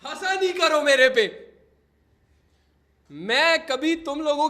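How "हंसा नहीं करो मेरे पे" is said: loud, fed-up shout at chat; loud exasperated scolding; exasperated yell. Real, not acted. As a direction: yell it out, half annoyed, half laughing. Streamer scolds chat for laughing.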